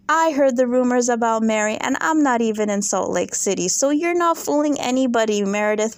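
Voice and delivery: usual speaking voice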